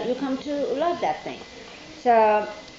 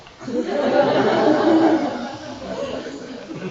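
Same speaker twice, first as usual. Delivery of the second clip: real laugh; 3 semitones higher, 5 dB louder than usual. Real, not acted. Laughing at her own story.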